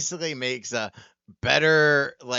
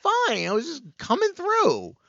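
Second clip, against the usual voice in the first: high-pitched, incredulous protest; acted-out bafflement; mock exasperation; 12 semitones higher, 3 dB louder than usual. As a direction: plead your innocence, hammed up. Acting out baffled reply in quarrel.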